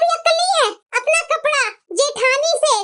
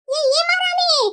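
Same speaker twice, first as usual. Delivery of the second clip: ordinary talk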